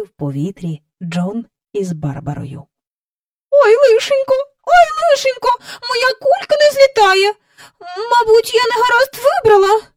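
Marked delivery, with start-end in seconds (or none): 3.5-9.8 high, loud fretful wail; flustered lady. A narrator's reading. A woman under Jane's elbow wailing that her balloon won't rise. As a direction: wail in a panic.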